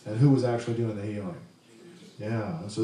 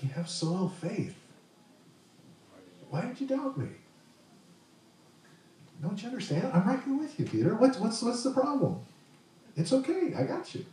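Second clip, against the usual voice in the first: put-on tender voice for a child; 10 semitones higher than usual. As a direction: gently chide a scared child. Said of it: "same tone of voice that we would with a child".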